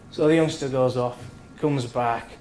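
ordinary delivery

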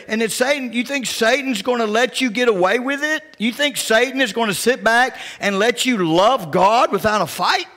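ordinary talk

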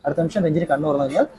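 low, soft hypnotist voice; hypnotic suggestion. Real, not acted. Hypnotist telling a subject who she will see, low and hushed.

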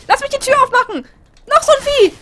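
panicked high-pitched yelling; fleeing in a panic, shrieking; being chased. Real, not acted. Fleeing monsters, screaming. (panisch schreiend auf der Flucht)

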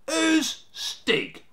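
drawn high "who's", then dropping; loud, high, suspicious query. A narrator's reading. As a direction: question it warily, voice leaping up. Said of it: "the eldest Snarget asked suspiciously".

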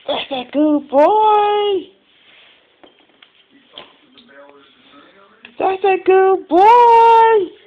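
sing-song praise. Real, not acted. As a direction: draw it out sweetly for the dog. Praising the dog.

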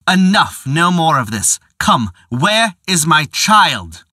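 sharp, loud, rising to a slow demand; angry demand. Acted. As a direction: cut her off in fury. Father angrily cuts wife off.

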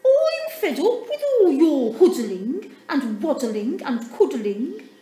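high, loud, laughing little penguin; Dudley, fed up. A narrator's reading. Dudley complains to his family, high and loud. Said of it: "said Dudley".

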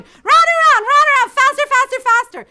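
very high, loud breathless rush of narration; excited, high-pitched shouting; frantic chase. A narrator's reading. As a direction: whip the chase up at full tilt.

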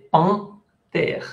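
"Pan" nasal, then "terre" apart; two syllables, the "Pan" through the nose; nasal vowel demo. Shown as a demonstration. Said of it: "se pronuncia nasal".

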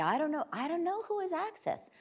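bewildered users. Retold or imitated. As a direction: voice someone at a loss. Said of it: "And so they're left thinking".

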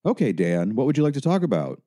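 chatty helper with a high, quick lilting question; overly casual phone-counsellor voice. A narrator's reading. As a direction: ask breezily, pitch climbing. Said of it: "David's voice was instantly louder and yet too conversational".

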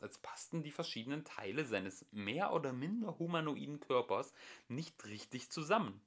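usual speaking voice

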